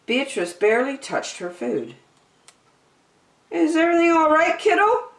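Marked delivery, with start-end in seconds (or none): 3.5-5.1 father's voice. A narrator's reading. Father asking daughter at supper.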